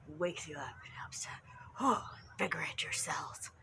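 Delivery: whispery talk while shivering cold. Real, not acted. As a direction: speak through the cold shivers. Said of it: "Oh, I'm freezing".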